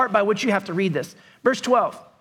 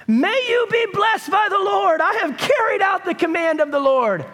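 overly religious Saul; 7 semitones higher than usual. Retold or imitated. Pastor mocks Saul's smug greeting in a high sugary voice. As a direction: lay on the holy-sounding sweetness.

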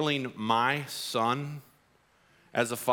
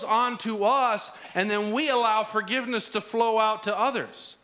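full-force preaching; 10 semitones higher, 3 dB louder than usual. Acted. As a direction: drive the point home to the congregation.

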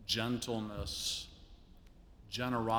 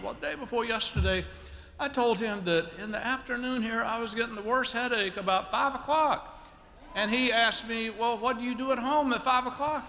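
much higher, genteel lady voice; imitating Gwen; 12 semitones higher, 4 dB louder than usual. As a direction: lift into a refined, high lady voice telling a story. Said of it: "I can't do her elegant accent".

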